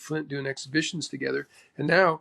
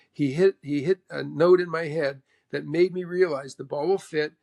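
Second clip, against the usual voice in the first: plain reading voice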